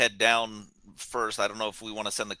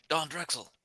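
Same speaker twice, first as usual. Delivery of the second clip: high but soft, held-back call; pretend yell; 6 semitones higher, 6 dB quieter than usual. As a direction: call out a name without real volume. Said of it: "I'll, I'll yell out".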